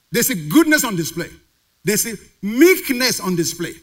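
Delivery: emphatic preaching, key words punched. Real, not acted. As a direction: preach it, building to a loud stretched final question. Preaches with rising force.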